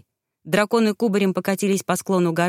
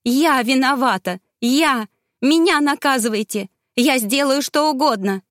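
pleading; 5 semitones higher than usual. Acted. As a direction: beg him to spare his life. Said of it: "взмолилась Равена". (Равена на коленях умоляет Мегарея пощадить Кейлуса)

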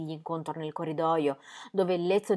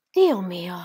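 a loud, exasperated "Dio mio!"; exclaiming; 8 dB louder than usual. A narrator's reading. Ingram to Ingeborg, pacing the room, loud exasperated exclamation. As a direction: burst out in frustration. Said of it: "esclamò".

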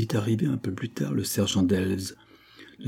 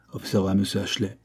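plain reading voice

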